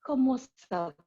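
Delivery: high-voiced French pleasantries. Shown as a demonstration. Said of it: "I can switch".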